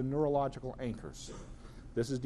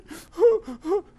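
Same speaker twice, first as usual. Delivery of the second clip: loud, high hammed-up sob; mock sobbing gasp; 14 semitones higher, 9 dB louder than usual. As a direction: gasp and sob like a crying little girl. Hurt child crying, acted.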